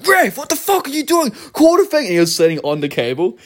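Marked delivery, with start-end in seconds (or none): 0.1-2.0 high-pitched angry yell at the ref; irate water boy. Retold or imitated. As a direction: shout like a player raging at the referee. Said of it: "he was getting really, and going".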